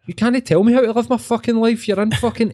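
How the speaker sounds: high-pitched mock outrage, hammed up; mock angry rant. Mock rant at online life coach.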